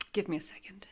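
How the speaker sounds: whispered, fighting tears; welling up. Real, not acted. Reader tearing up, asking viewers for a second. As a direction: ask for a moment through tears. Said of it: "making me so emotional".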